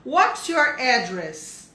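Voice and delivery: very slow, drawn-out question; very slow model question. Shown as a demonstration. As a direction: stretch it out for the class. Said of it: "Então, ó".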